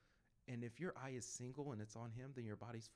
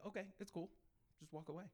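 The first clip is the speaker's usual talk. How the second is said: higher-pitched, light retold shrug-off; easygoing acceptance; 6 semitones higher, faster than usual. Rejected prayer walker backing off, easygoing. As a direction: let it go without a fight.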